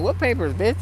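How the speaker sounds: retold defiant snap at the boss; indignant retort. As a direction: throw it back at him hard.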